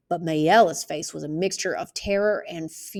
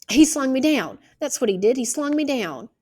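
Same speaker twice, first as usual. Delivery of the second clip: high, louder insistence; a witness cornered, insisting louder; insistent; 7 semitones higher, 4 dB louder than usual. Acted. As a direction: push it out, defending herself. Mayella insisting under cross-examination.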